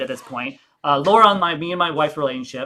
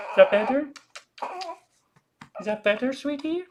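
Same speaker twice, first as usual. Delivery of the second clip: high, soft soothing coo; 9 semitones higher, 6 dB quieter than usual. Real, not acted. Soothing baby.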